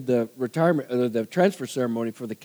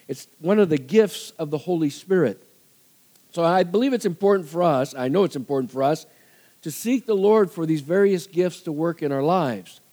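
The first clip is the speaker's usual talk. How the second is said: ordinary delivery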